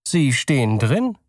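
polite clerk. Acted. Clerk voiced in story.

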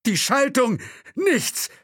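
very high, loud panicked exclamations; panicked cry. Acted. Driver rattling the gearstick, crying out in panic. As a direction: cry out each discovery in panic. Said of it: "Panik in der Stimme".

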